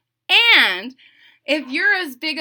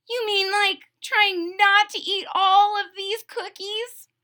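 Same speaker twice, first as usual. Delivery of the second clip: higher, slow doubtful toad; Toad's voice; 11 semitones higher, 4 dB louder than usual. Acted. Toad questioning Frog about the cookies, higher and slow. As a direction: ask in disbelief.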